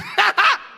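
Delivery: sudden high yelp of laughter cut off; short high burst laugh. Retold or imitated. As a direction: laugh in a quick spurt then stop. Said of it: "we laugh like we have A D D".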